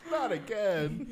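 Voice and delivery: mock dismay. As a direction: stretch it out, hammed-up despair.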